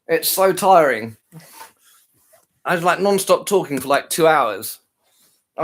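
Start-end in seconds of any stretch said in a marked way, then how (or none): 0.1-1.2 weary. Real, not acted.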